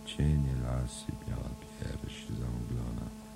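soft, slow sleepy mumble; murmuring. Acted. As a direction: murmur it dreamily, half asleep. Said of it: "Stefan mruczy". (senne, ciche mruczenie)

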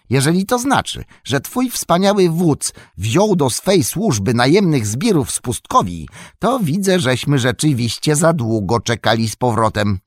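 loud, high indignation; indignant anger. A narrator's reading. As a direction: scorn the hired thugs. Merry angry at gatekeeper. (Merry oburzony, głośno)

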